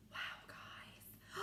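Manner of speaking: hushed wow; breathy awed whisper. Real, not acted. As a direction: whisper in awe. Whisper to class.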